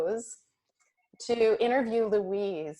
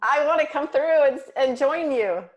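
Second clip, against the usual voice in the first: eager; 5 semitones higher, 5 dB louder than usual. Real, not acted. Eager to taste too.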